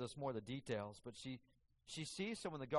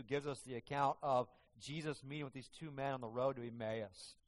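normal talking voice

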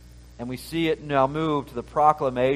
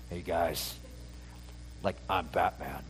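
mock-cool, low and much softer, like a breezy Batman reveal; casual superhero reveal; 9 semitones lower, 12 dB quieter than usual. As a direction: toss it off like a laid-back superhero. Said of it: "I don't think he was like".

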